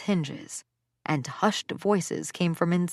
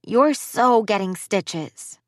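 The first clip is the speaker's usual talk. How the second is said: put-on slow, louder kid taunt; 4 semitones higher, 4 dB louder, slower than usual. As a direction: tease her loud enough to hear. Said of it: "said more loudly".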